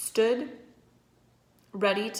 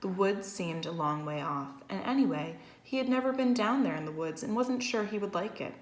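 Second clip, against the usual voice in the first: ordinary delivery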